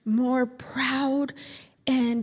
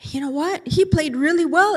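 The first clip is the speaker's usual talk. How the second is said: ordinary delivery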